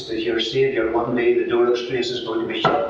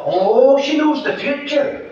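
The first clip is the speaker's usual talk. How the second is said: put-on high, awed old woman's voice; impressed old lady; 12 semitones higher, 5 dB louder, slower than usual. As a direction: gush like a believing old dear. Old lady boasts of fortune teller.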